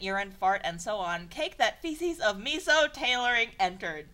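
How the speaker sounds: ordinary talk, nothing marked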